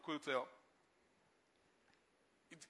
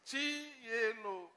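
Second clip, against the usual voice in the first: slow, softer, tender calling of the name; a slow, softer repeat of Chinyelo; drawn out; 6 dB quieter, much slower than usual. Acted. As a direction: let the name linger gently.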